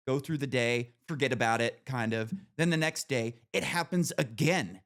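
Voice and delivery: ordinary talk, nothing marked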